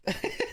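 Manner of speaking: high, breathy laugh. Real, not acted. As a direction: let out a high, quiet laugh. Reacting to the news of departure.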